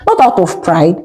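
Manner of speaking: ordinary delivery, nothing marked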